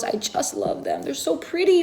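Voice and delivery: breathy whispered adoration; hushed, lovestruck whisper; swooning whisper. Real, not acted. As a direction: whisper it like a secret crush. Swooning over palladium windows.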